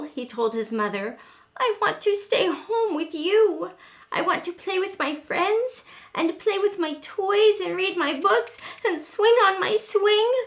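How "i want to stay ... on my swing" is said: put-on high, louder child voice begging to stay home; pleading little raccoon. A narrator's reading. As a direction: beg like a small kid.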